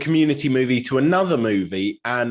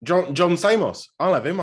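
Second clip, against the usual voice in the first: usual speaking voice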